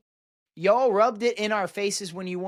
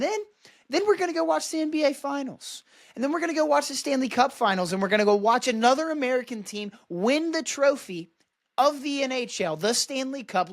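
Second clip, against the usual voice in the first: gloating build; 6 semitones higher than usual. Real, not acted. Building list of American sports.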